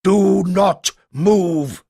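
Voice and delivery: slow, loud, menacing command; psychotically angry. A narrator's reading. Giant voice threatening the lost pair, slow angry shout. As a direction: bark it out like a furious giant. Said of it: "sounded psychotically angry".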